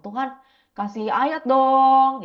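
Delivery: slow, drawn-out plea; begging God. Retold or imitated. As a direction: stretch it out like asking God for a favour. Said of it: "Aku juga selalu bilang sama Tuhan".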